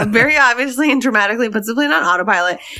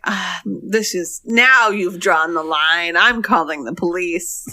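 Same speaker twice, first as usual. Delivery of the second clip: slow, lower, mock-stern; calm dumb husband; 4 semitones lower, slower than usual. Husband threatens the pilot calmly, slow and low. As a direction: drawl it like he's in charge. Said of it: "he's like".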